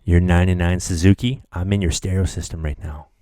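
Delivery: creepy teasing. Pretend. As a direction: lean in like a stalker joking. Creepy host teasing listeners.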